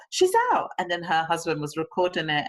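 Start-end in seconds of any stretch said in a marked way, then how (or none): 0.1-0.7 high, louder cry of relief; thrilled mother. Retold or imitated. Friend retelling her caesarean, high joyful cry. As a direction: squeal it out with joy. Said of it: "She said".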